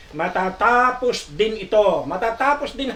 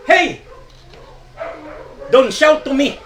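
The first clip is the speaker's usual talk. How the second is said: sharp, higher and louder scolding; angry retort; 5 semitones higher, 5 dB louder than usual. Retold or imitated. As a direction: snap back at the shouting passenger. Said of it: "nagalit din po ito pong konduktor".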